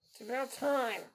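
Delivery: usual speaking voice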